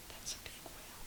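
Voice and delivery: breathy whispered aside. Acted. Narrator whispering to the listeners about the huge whale. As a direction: whisper it like a secret to the children.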